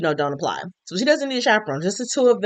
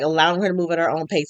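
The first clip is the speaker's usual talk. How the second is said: ordinary talk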